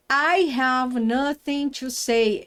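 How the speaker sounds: each word slowed for learners; slow model sentence. Shown as a demonstration. Teacher models the sentence slowly. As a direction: read it out slowly for the class. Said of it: "Então, eu falo na T V".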